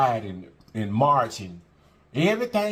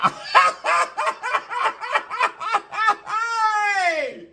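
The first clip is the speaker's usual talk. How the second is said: big laugh. Real, not acted. Vlogger bursting into laughter after her hair joke. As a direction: crack up at your own joke.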